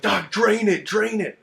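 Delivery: high, loud imitation of excited buddies; eager urging. Marines egging Doc on to drain a blister, excited. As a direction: egg him on, imitating a loud high clamor.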